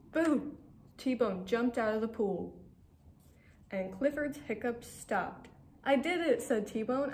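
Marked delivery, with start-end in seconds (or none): 0.1-0.6 scary boo. Acted. T-Bone jumps out to scare. 5.8-6.4 triumphant. Acted. T-Bone cheers triumphantly.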